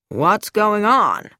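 slow, drawn-out demand. A narrator's reading. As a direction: demand an explanation slowly. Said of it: "stopped and demanded".

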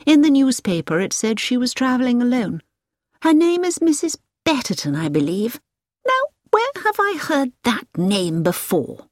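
put-on a knowing, musing older lady; prim English lady. A narrator's reading.

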